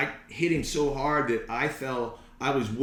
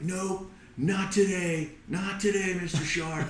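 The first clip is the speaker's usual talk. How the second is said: retold angry defiance; 7 semitones higher than usual. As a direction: act out telling off a shark. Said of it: "I was so angry".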